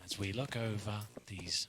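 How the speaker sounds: slow hushed wildlife narrator; nature documentary. Pretend. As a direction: savour each word like a nature film. Joking nature documentary bit.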